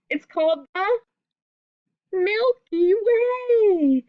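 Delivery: Milky Way sung out with wonder; big reveal. Acted. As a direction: stretch out Milky Way like a wonderful surprise. You're gonna love the name.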